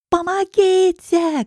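very high, very slow, loud wail for help; a last desperate cry; desperate scream. Acted. Girl about to be shoved into the car, screaming desperately. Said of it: "кричу я отчаянно".

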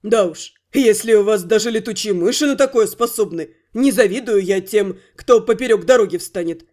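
gruffly amused; amused werewolf. Acted. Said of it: "хохотнул развеселившийся Фильгард".

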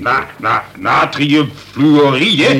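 slow, halting try at natriumfluoride; baffled slow repeat; stumbling over word. Acted. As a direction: sound out natriumfluoride syllable by syllable. Colonel stumbles over chemical name.

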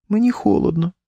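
high, feeble complaint; weak wife's voice. A narrator's reading. Ailing wife complains to her husband of cold, weakly. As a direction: complain weakly, pitched right up. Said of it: "сказала вона слабким голосом".

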